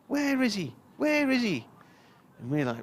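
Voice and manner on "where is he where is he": put-on high, loud, frantic old man calling out; old man shouting. Little old man searching the stand, shouting high. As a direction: shout it like a frail grandad hunting someone. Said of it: "comes running along the front of the stand shouting".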